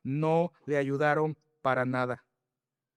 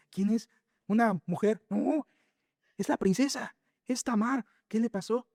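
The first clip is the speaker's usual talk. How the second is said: gossiping onlookers; 6 semitones higher than usual. Acted. Voicing shocked Jerusalem onlookers.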